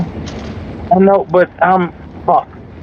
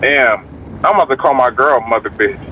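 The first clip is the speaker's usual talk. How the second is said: usual speaking voice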